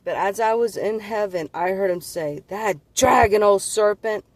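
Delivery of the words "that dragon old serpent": raised, much louder imitation of wrathful speech; viciously angry retelling. Retelling God's words about the old serpent, viciously angry. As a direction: burst out higher and louder, imitating fury. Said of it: "like, viciously angry".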